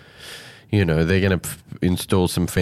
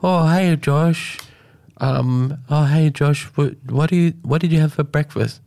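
higher, lightly put-on friendly interviewer voice; imitating Mark; 6 semitones higher than usual. Co-host imitating Mark buttering up the minister, light higher voice. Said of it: "Mark can ask".

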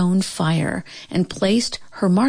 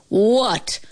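ordinary delivery, nothing marked

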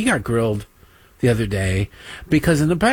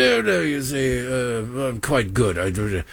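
flustered Englishman; 3 semitones lower than usual. Retold or imitated. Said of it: "British man declining a date".

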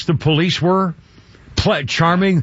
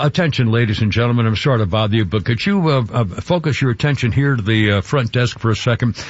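lightly voiced counter clerk, pitched lower; microphone announcement; 7 semitones lower than usual. Reservation clerk addressing the waiting passengers, mock-official announcement. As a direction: imitate a polite, low public announcement. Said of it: "picked up the microphone and said".

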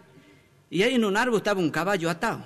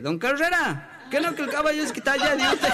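raised-pitch call to a householder; 6 semitones higher than usual. Acted. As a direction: call out high, as if at someone's door. Caller at a stranger's house.

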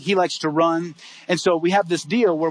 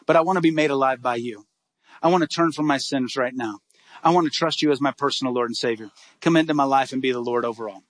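lower, earnest prayer voice; 5 semitones lower than usual. Acted. Pastor leading teen boys in a prayer, low earnest prayer.

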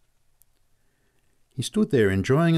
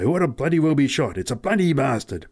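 higher, louder self-cursing; cursing himself; 5 semitones higher, 5 dB louder than usual. Acted. Pat cursing himself for being late, loud angry swearing. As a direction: berate yourself out loud.

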